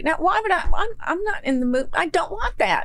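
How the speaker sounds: high-pitched, put-upon imitation of a refusal; irritated refusal. Wife refusing husband's offer, irritated high-pitched imitation. Acting out her snappy refusal.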